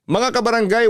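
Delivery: high, bright call to the audience; radio host's cheerful opener; host greeting. Acted. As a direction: hail the listeners. Radio host addresses listeners.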